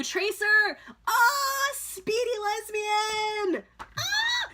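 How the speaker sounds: high, loud, drawn-out squeal; excited fangirl squeal. Real, not acted. Fan greeting her favourite character, excited squeal. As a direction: squeal with glee, stretching it out.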